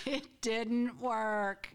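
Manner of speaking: very slow, dragged-out deadpan; slow comic letdown. Real, not acted. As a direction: drag it out for comic effect. Punchline after failed lesson.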